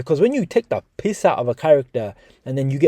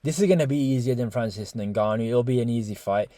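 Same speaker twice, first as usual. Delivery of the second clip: soft, lower-pitched, laid-back imitation; 5 semitones lower, 6 dB quieter than usual. YouTuber mimicking trainer predicting easy fight, laid back. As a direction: play it as no trouble at all. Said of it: "Just laid back, like".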